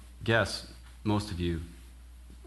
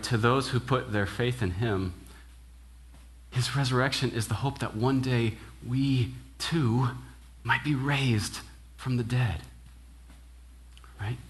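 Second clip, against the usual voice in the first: ordinary delivery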